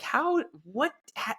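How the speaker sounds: high, stammered confused gasp; mock bafflement. Narrator spots the president vanish, high and baffled. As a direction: splutter it high in disbelief. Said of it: "and I was like".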